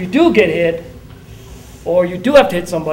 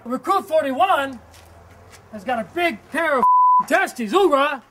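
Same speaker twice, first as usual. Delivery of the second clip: drill sergeant; 9 semitones higher, slower than usual. Acted. Instructor praises recruit before boxing.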